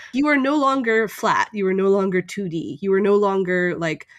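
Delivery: ordinary delivery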